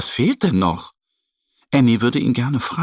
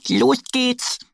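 much higher eager shout; eager duck. Acted. Annie starts them off, high eager voice. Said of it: "sagt Annie".

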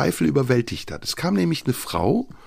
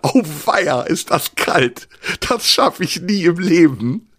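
shivering dismay; 4 semitones higher, slower than usual. Retold or imitated. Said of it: "der erste Wassertropfen meine Füße berührt hat".

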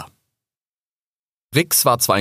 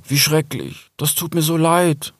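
higher, dismayed fox voice; pitying, raised voice; shocked sympathy; 9 semitones higher than usual. Acted. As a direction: offer heartfelt condolence. Fox hears of friend's loss.

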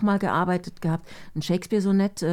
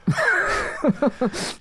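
high bright burst of laughter; 9 semitones higher, 3 dB louder than usual. Real, not acted. Laughing at her own joke about waitressing. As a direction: laugh it off at yourself.

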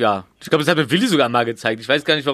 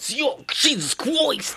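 imitating the preacher catchphrase; 8 semitones higher, 4 dB quieter, much slower than usual. Repeats the Mike catchphrase.

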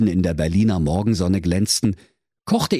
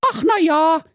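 very high squeaky Berlin woman; dim young mother; 4 dB louder than usual. Acted. Trulla answering the doctor, high squeaky shrug.

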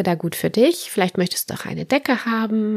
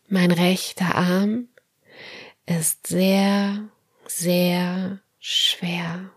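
guided meditation; slower than usual. Acted. Leads autogenic training relaxation.

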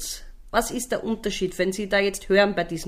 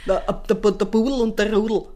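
Upper Austrian; 3 dB louder than usual. Retold or imitated. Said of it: "das sind die Oberösterreicherinnen".

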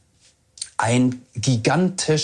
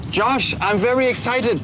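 excited; 9 semitones higher than usual. Real, not acted. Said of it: "hat man das in meiner Stimme ein bisschen gehört".

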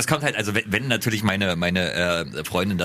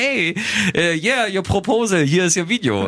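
imitating her cheerful handover, higher; mock English tourist; 6 semitones higher than usual. Retelling how she came up and said it, imitated higher.

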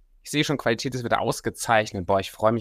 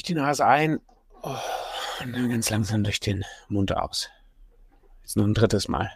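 sultry narrator. Acted. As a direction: talk them calmly through each breath. Leads a breathing exercise.

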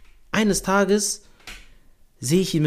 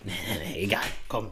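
soft, low, brushing it off; hushed dismissal; 6 semitones lower, 8 dB quieter than usual. Retold or imitated. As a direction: wave it away under your breath. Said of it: "sie denkt sich so".

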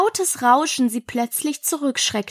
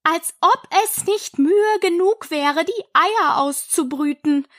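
high, lamenting bird voice; aggrieved pigeon; 6 semitones higher than usual. A narrator's reading. Pigeon lists hiding places tried, aggrieved. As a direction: list each failed attempt plaintively.

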